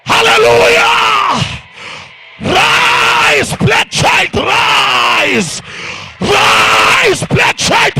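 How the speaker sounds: high, loud shouted call, slow and stretched; climactic preaching cry. Acted. Preacher rallying the crowd to rise, climactic shout. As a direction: cry it out at the peak of the sermon.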